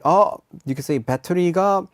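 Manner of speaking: ordinary talk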